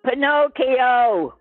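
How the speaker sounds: loud, high, drawn-out answer. Real, not acted. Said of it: "can you speak a little louder".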